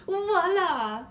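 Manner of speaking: loud, proud showman's cry; grand unveiling. Acted. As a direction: present the hat with a flourish. Milliner unveils the hat.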